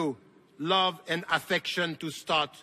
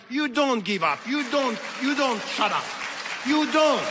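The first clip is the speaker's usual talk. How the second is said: rising emphatic preaching; 6 semitones higher than usual. Real, not acted. Preacher urging the crowd to never stay silent, building emphatic shouts. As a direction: hammer home you don't, building to a near shout.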